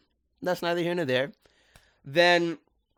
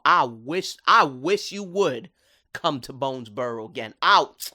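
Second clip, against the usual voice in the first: tough mama threat; 5 dB louder than usual. Retold or imitated. Mama Clawthorne threatening Wortlop in his cage, hammed up. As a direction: play the mom who'll beat you up. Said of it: "She said".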